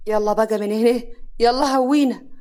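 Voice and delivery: loud, harsh dismissal, driving her out; shouting her out. Acted. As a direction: yell at her to get out now. Husband throws wife out.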